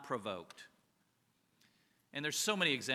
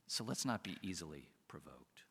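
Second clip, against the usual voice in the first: hushed, softer whisper; 8 semitones lower, 8 dB quieter than usual. Real, not acted. Pastor whispering the lesson to his congregation. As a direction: breathe it out as a gentle whispered plea. Soft exhortation after laugh.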